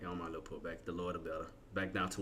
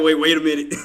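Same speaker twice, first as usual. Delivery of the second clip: loud, higher-pitched reenacted alarm; retold alarm; 6 semitones higher, 15 dB louder than usual. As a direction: call out like you just spotted a mistake. Retells his alarm at trade.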